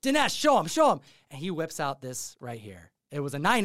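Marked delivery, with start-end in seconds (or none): 0.0-1.0 high, louder, egging a friend on; excited urging. Retold or imitated. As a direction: push him to show it off. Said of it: "they're like".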